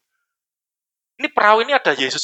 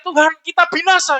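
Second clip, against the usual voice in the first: higher-pitched mock cry of alarm; imitated cry for help; 7 semitones higher than usual. Preacher retelling the disciples' panic, imitated cry for help. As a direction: mimic disciples crying out, no real shout. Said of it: "Bahkan mereka teriak-teriak".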